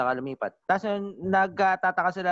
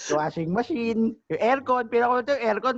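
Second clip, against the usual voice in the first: hammed-up disbelief; 8 semitones higher than usual. Exaggerated retelling of missing appliances.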